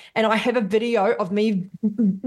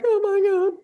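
overwhelmed gasp; 11 semitones higher than usual. Retold or imitated. Retells crying at statue.

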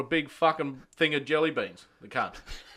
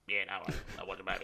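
dopey mumble; 5 semitones lower, 9 dB quieter, faster than usual. Retold or imitated. Imitating the workmate agreeing.

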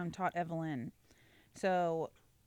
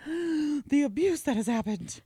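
high, loud, slow mock outrage; hammed-up toddler lament; melodramatic toddler; 9 semitones higher, 8 dB louder, slower than usual. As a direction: wail it like a wronged little diva.

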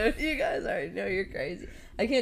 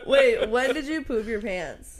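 slow, laughing through the question; laughing at the sumo wrestler joke; 3 semitones higher, much slower than usual. Real, not acted. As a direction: ask it while cracking up.

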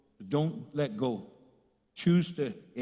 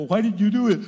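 loud mock parental demand; scolding parent; 9 dB louder, faster than usual. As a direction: act out a parent confronting a child. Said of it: "your parents go".

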